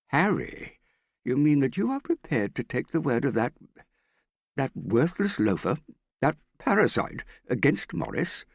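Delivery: high, loud scornful disbelief; scandalised father in full indignation; indignant outrage. A narrator's reading. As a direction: spit out the insults, outraged. Father outraged at Harry's word.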